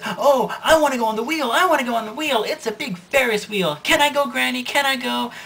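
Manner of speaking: put-on high, loud whiny child voice; a small girl begging her granny, high and loud; little girl pleading. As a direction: beg like an excited eight-year-old. Little girl begs to ride.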